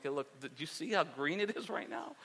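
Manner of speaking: quietly marvel, as if at something precious; hushed admiration. Retold or imitated. Admiring his lawn to wife.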